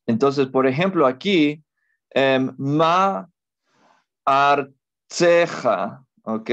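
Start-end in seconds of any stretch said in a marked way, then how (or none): 4.3-6.0 artzeja drawn out very slowly, syllable by syllable; pausal pronunciation. Shown as a demonstration. Teacher slowly modelling artzeja for students. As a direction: stretch out artzeja slowly for the class. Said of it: "Ma artzeja de Eretz".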